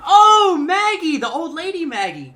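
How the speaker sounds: high, loud burst of realization on Oh; sudden recognition. Retold or imitated. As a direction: shout it out as it clicks. Recognizing his mom's coworker.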